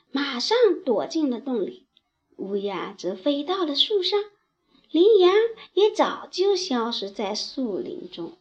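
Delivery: usual speaking voice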